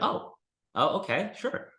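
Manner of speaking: high, surprised okay; surprised agreement. Retold or imitated. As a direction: imitate a startled seller agreeing. Sellers' surprised reply imitated.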